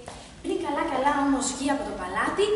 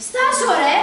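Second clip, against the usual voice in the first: loud, higher, rough stop; shout; 6 semitones higher, 11 dB louder, slower than usual. Acted. The arap shouts at Giannis to stop, loud and rough. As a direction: bark the command to halt.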